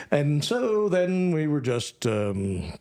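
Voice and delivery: ordinary talk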